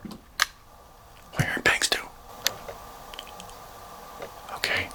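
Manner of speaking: hushed, breathless whisper; nervous whisper. Real, not acted. Spotting something strange in the dark room, nervous whisper. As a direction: whisper so nothing hears you.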